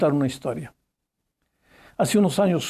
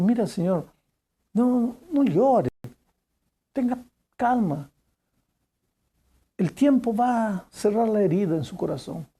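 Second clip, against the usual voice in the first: put-on consoling girl; 5 semitones higher than usual. Girl comforts a weeping father.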